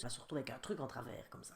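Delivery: soft unvoiced aside; whisper. Real, not acted. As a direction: breathe it as a secret fear.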